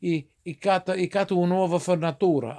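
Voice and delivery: Italian-accented "fornatura", vowels on every ending; Italglish. Shown as a demonstration. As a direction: dialect phrase in an Italian accent. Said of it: "But you say it like".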